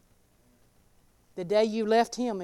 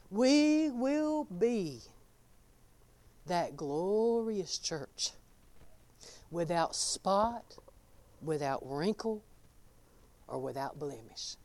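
slow solemn; 4 semitones lower, 8 dB quieter, slower than usual. Acted. Solemn slow sermon climax.

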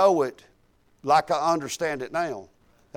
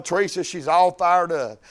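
ordinary talk, nothing marked

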